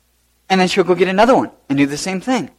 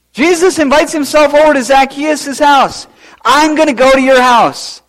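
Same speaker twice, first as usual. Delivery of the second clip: excited preaching; 9 semitones higher, 6 dB louder than usual. Real, not acted.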